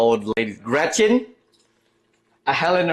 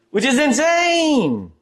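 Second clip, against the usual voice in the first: incredulous; 11 semitones higher, slower than usual. Real, not acted. Reacting to car prices.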